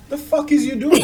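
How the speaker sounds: high, disbelieving and half laughing; mocking disbelief at a poser; incredulous retort. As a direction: throw it back at them in disbelief. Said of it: "I'm looking at them like".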